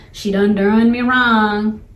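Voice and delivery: slow, drawn-out folksy lament; hammed-up wronged party; mock aggrieved complainer. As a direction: stretch it out like a wailed grudge. Voices old grudge in folksy idiom.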